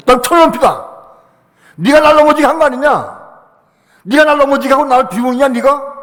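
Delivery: high, loud rebuke; scolding the devil. Shown as a demonstration. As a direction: tell the devil off. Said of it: "마귀한테 그래야 돼".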